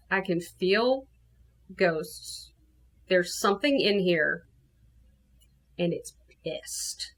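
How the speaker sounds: soft, halting, dropping away; ominous hush. Retold or imitated. Telling friend a ghost is here, ominous hush. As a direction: let it sink quietly with pauses between phrases.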